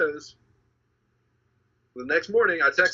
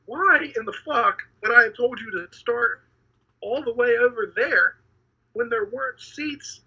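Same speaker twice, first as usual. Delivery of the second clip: exasperated rant; 6 semitones higher, slower than usual. Retold or imitated. Retold angry outburst at friend.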